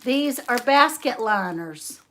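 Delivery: ordinary talk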